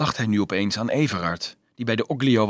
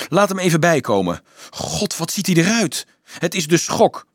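raised, higher-pitched worry; agitated concern; 6 semitones higher, 3 dB louder than usual. A narrator's reading. Bystanders worried over shocked Dolf, agitated raised voice. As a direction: speak up in concern.

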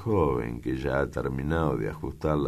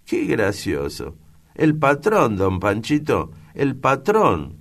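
put-on girl delighted to name the boss as her godfather; proud excited reveal; 9 semitones higher, 7 dB louder than usual. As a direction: savour the big news, drawing out the last words.

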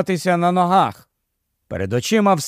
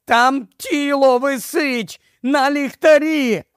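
high, slow, desperate cry; wailing yell; 9 semitones higher, slower than usual. A narrator's reading. Ed crying out to the crowd about the lamppost. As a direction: howl it so everyone looks up. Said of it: "заволав Ед".

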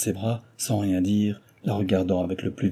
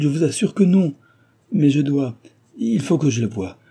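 agitated exclamation; 6 semitones higher, 4 dB louder than usual. A narrator's reading. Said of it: "s'écria Cécile".

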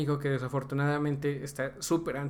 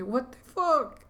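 high-pitched incredulous outburst; baffled shock; 11 semitones higher, 4 dB louder, much slower than usual. Retold or imitated. Retelling his reaction to the video lady, high and incredulous. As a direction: let the voice leap up in disbelief.

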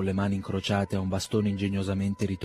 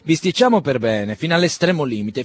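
rising challenge; 7 semitones higher, 9 dB louder than usual. Acted. Quarrel escalating between friends.

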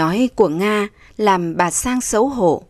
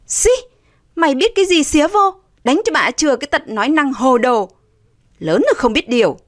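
higher, scornful scolding; still angry; 6 semitones higher, faster than usual. A narrator's reading. Older sister snapping at younger sister, still angry. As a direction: brush off the interference crossly. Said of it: "mặt vẫn còn tức".

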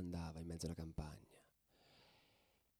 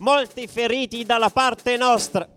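much higher and louder, pushing through the brawl like a war report; fired-up battle telling; 22 dB louder than usual. Acted. Ultra reliving the train ambush for listeners, loud and excited. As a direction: tell the train fight at full shout, breathless with it. Battle scene told at full force.

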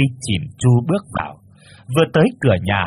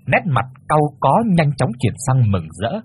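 ordinary delivery

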